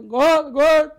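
high, very loud burst; acted-out involuntary yell; imitated tic outburst. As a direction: blurt it out as a sudden shouted tic. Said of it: "Só que aí eu seguro, eu fico".

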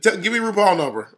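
loud, high dismissive brush-off; mock brush-off. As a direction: toss it off loudly in her voice. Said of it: "she was like".

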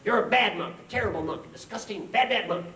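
high, loud mock tirade; hammed-up furious telling-off; angry scolding monk. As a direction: hammer out the insults like an outraged monk. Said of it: "is angry".

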